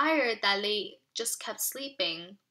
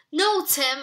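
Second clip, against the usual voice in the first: louder, higher pleading cry; frightened cry; 6 semitones higher, 7 dB louder than usual. Acted. Amy cries out as Tim goes into the shed, high pleading cry. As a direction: cry out to stop him. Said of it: "I cried".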